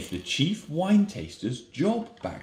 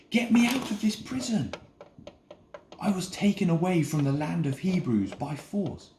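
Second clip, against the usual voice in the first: Joseph pleading; 7 semitones higher, 3 dB louder than usual. Acted. Joseph begs to be remembered.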